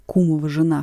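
ordinary delivery, nothing marked

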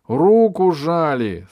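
loud, high, slow bitterness; drawn-out bitter protest; bitter reproach. A narrator's reading. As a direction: throw it back at them, wounded and accusing. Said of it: "сказал с горечью Пашка".

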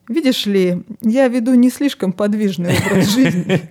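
patient talking tree, laughing through; a laughing, patient tree explaining. Retold or imitated. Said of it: "дерево выдерживает терпеливую паузу и".

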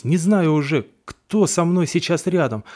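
ordinary delivery

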